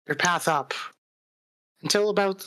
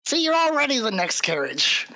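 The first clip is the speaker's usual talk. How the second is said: menacing goblin leader, pitched up; taunting goblin bandit; 13 semitones higher than usual. Acted. Game master voices goblin ambusher.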